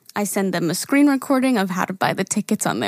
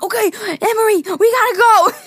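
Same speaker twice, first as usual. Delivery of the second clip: urgent dad; 10 semitones higher, 4 dB louder than usual. Retold or imitated. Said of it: "he's like".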